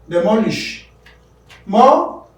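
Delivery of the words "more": More said alone, much higher and louder; teacher isolating the stressed syllable; isolated stressed syllable. Shown as a demonstration. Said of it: "that is stressed".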